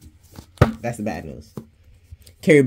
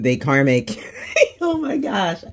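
high, squealed laughing outburst; 7 semitones higher, slower than usual. Real, not acted. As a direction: crack up mid-sentence, voice shooting way up. Cracking up at her own card.

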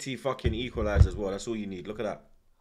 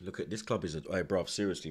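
ordinary talk

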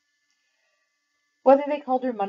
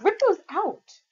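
sharp, much higher, louder order; angry command; 13 semitones higher, 6 dB louder, slower than usual. Acted. Ruth ordering Mercy to rip out earrings, angry. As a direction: snap it at her like a stern scold.

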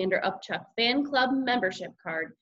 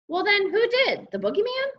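high, louder sarcastic questioning; exasperated mother; 12 semitones higher, 4 dB louder than usual. A narrator's reading. Mother questioning son about mess, exasperated. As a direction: scold with pitched-up disbelief.

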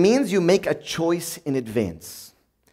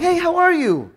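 high, sugary hello after screaming; mock-cheerful phone voice, anger hidden; sudden sweet phone greeting; 10 semitones higher than usual. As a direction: switch instantly to a friendly caller voice. Sweet phone greeting mid-rage.

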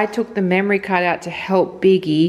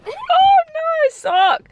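surprised; 4 dB louder, much slower than usual. Real, not acted. Dog grabs sock.

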